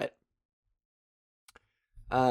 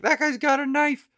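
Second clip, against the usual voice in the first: warning yell; 12 semitones higher, 3 dB louder than usual. Retold or imitated. Group yelling warning.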